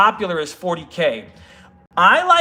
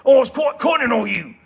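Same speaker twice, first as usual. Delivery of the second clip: higher-pitched, hammed-up Cockney; put-on Cockney, pitched up; British Cockney accent; 4 semitones higher than usual. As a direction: exclaim it like a playful Cockney. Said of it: "say it with a British Cockney accent".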